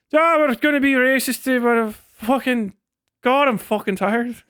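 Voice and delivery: mock Irish accent, drooping into weary; a lightly put-on Irish brogue, then a tired sag. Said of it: "think I'm doing a Scottish accent anyway".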